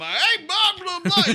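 high, loud cartoon voice; cartoon character Bernie. Voice actor demonstrating his cartoon character, high loud babble. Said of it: "Je joue un personnage qui s'appelle Bernie".

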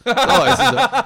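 sudden high, loud burst of glee; excited outburst. Real, not acted. Laughing at Mike, yelling ah ouais excitedly. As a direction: pounce on it like you called it. Teasing Mike, he saw it coming.

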